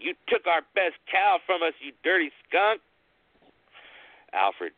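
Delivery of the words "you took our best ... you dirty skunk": a touch of a furious yell; mild mock yell. Grieving brother scolding the scarecrow, mild mock yell. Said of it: "Thomas yelled at it and cursed at it".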